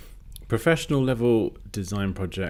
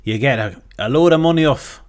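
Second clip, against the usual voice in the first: loud, high hammed-up West Country sales pitch; a broad West Country huckster selling his course; over-the-top West Country advert voice; West Country accent; 9 semitones higher, 8 dB louder than usual. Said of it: "West Country accent version".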